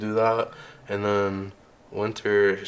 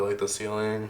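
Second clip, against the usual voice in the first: normal talking voice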